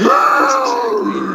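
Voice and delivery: squeaky voice. Retold or imitated. Mimics squeaky voice agreeing.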